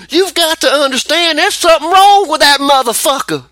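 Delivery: high, loud angry outburst; heated rant. Real, not acted. Radio host ranting to his co-host about the president, heated. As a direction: let the anger burst out on the last line.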